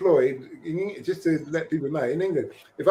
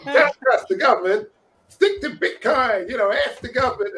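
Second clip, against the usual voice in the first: raised, emphatic attempt at Canadian; rally them in a put-on Canadian accent; 8 semitones higher, 5 dB louder than usual. Trucker urging others toward Bitcoin, Canadian accent. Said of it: "That's my best Canadian accent".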